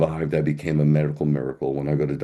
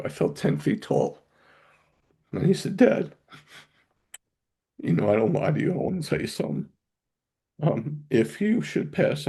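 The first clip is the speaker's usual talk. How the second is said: choked up; 9 semitones higher than usual. Real, not acted. Father recalling last words with his son, choked up. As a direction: voice catching high with tears.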